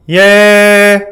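loud, high held "예"; big, full singing-exercise call; heavy chest voice. Shown as a demonstration. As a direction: belt out a sustained chest-voice note. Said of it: "하고 소리를 낼 때는".

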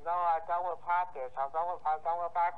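ordinary talk

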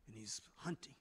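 whispered, stealthy narration; hushed storytelling. A narrator's reading. Preacher narrates the warrior searching for a lion, hushed. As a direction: drop to a suspenseful whisper.

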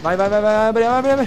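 urgent. Real, not acted. Urging door to open in panic.

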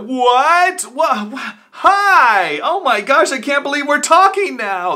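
over-excited greeting. Shown as a demonstration. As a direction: gush like you can't believe it's them. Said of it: "who's excited by that?".

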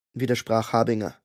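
ordinary delivery

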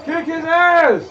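loud cheer. Real, not acted. Gamer cheering his fighter to beat the boss, loud cheer. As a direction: urge him on, loud and high.

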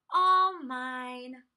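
loud gloating sigh on ALL; sighing possessively. A narrator's reading. Minosaur hugging the toys, sighing possessively. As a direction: sigh it out, savouring the hoard. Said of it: "hugged the toys and sighed".

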